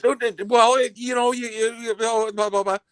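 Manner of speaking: high-pitched mocking stammer; high mock whine trailing into blahs; flustered excuse-making. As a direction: imitate her babbling, tripping over every word. Mimics girlfriend's flustered excuse.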